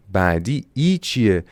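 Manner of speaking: usual speaking voice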